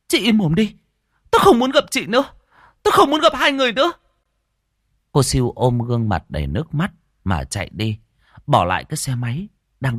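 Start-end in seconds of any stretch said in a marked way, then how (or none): 0.1-3.9 high, loud shrieked rejection; furious outburst. A narrator's reading. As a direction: lash out, faster and higher at the end. Jealous woman lashes out in tears. (gào lên giận dữ)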